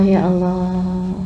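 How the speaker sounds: hushed, low plea to God; pleading prayer. Acted. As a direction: plead softly and helplessly, as if praying alone. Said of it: "Kamu berdoa manggil nama Allah". (doa lirih memelas)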